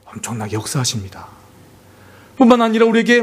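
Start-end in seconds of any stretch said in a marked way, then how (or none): 0.1-1.3 sudden hush. Acted. Sudden drop after build.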